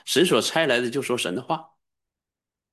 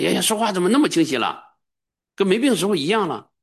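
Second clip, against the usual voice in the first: joyful relatives; 10 semitones higher, 4 dB louder than usual. Retold or imitated. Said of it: "亲戚朋友都高兴，说".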